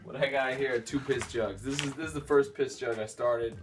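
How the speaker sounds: ordinary talk, nothing marked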